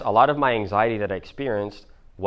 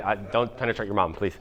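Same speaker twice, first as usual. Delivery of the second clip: usual speaking voice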